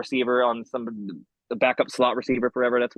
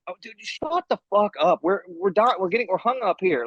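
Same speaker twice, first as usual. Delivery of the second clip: higher-pitched mock-annoyed griping; pissed-off exasperation; 5 semitones higher than usual. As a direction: complain like a fed-up fellow sufferer. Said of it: "just so pissed".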